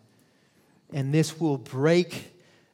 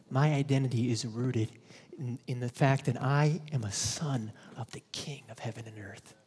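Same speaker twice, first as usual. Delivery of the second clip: ordinary talk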